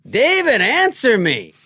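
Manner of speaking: high, loud mock shout of the name; loud, high late-night plea; demanding shout. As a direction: yell it like a needy friend at 3 a.m. Norm demanding at 3 a.m.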